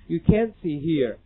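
slow, "can't" held with a stopped t; American can't. Shown as a demonstration. Teacher showing the American swallowed t. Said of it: "很有美音的特点，把这音吞了".

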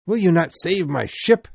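high, frantic cries of desperation; wild shout. A narrator's reading. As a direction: shout it wildly, pitch way up. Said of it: "shouted Ahab wildly".

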